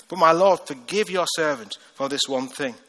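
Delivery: ordinary delivery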